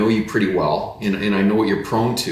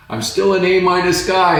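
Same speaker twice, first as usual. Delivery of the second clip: higher, louder mock yell across the room; 11 semitones higher, 6 dB louder than usual. Friend yelling across to the pastor, a hammed-up higher, louder yell. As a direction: call it out over a distance, higher and louder. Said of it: "He yells across to me".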